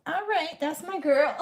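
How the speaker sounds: high, proud cheer with a laugh. Retold or imitated. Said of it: "And I was like".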